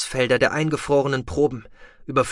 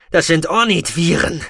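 loud, raised voice in combat; fierce taunt; 5 semitones higher, 6 dB louder than usual. A narrator's reading. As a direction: call it out at the enemy with menace. Villain taunts enemy after throwing glass.

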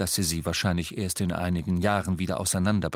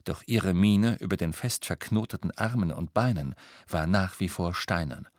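usual speaking voice

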